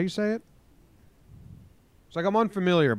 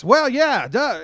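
much higher, louder mock radio-host voice; 13 semitones higher, 9 dB louder, slower than usual. Shown as a demonstration. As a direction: ham it up like a live radio host. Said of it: "you put your voice like this".

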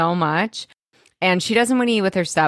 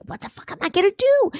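very high, rushed panic; hammed-up high-pitched panic; mock panic; faster than usual. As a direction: imitate frantic helplessness, fast and squeaky. Acts out Seth panicking on phone.

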